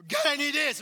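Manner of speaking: loud, high shouted demand; mock demanding prayer. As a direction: storm in and blurt it at full volume. Acts out storming into prayer.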